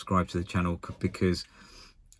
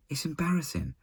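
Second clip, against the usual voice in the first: quoting Gio; 10 semitones higher than usual. Retold or imitated. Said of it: "Sorry, it's what Gio said".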